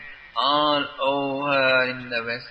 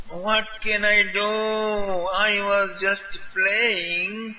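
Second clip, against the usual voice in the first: put-on playful higher boy's voice, slow on the end; lightly voiced Krishna; 6 semitones higher, slower than usual. A narrator's reading. Krishna shrugging off the scolding, higher and playful. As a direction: tease it off lightly. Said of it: "Krishna was not apologetic".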